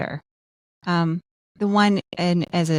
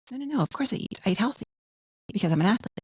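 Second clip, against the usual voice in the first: higher, softer protest, lightly acted; mock defensive athlete; 4 semitones higher, 4 dB quieter, faster than usual. Fit person insisting to a doubter they eat, mildly mock-defensive. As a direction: brush off the idea you don't eat.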